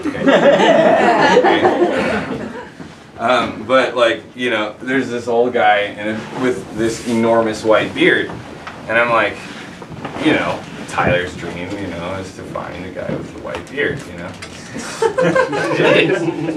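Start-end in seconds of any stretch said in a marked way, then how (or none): 0.3-2.9 cracking up, high and loud; high laugh. Real, not acted. Laughing at own bad accent. 14.7-16.6 laughing. Real, not acted. Laughing about own dream.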